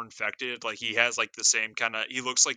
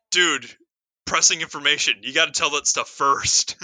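louder, higher scolding of a character; mock exasperation; 4 semitones higher, 7 dB louder than usual. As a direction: call them out, loud and incredulous.

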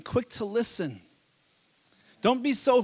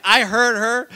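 ordinary delivery

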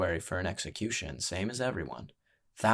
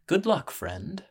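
ringmaster voice; 10 semitones higher, slower than usual. Acted. Ringmaster's imagined words.

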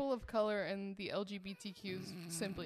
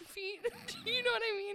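giggling, voice jumping high; laughing; 14 semitones higher, faster than usual. Real, not acted. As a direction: laugh through the words. Laughing at own cricket feet.

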